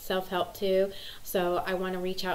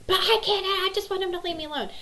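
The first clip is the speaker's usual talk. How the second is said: much higher, quicker whiny plea; imitating pleading daughter; 13 semitones higher, 5 dB louder, faster than usual. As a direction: rush it like an upset girl, pitched way up.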